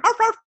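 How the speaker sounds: very high, loud mock yaps; imitated dog bark. Dolly answering the call, imitated high barking. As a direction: yap like a little dog.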